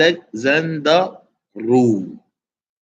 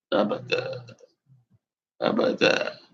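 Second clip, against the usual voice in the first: fading away; 7 dB quieter than usual. Shown as a demonstration.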